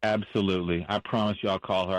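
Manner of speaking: ordinary delivery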